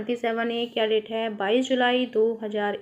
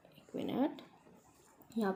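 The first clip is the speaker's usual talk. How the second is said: whispered aside; 13 dB quieter than usual. Real, not acted. Teacher aside while fixing slide.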